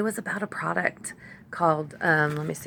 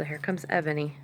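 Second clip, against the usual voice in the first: ordinary delivery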